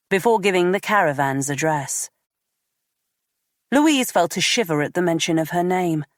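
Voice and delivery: usual speaking voice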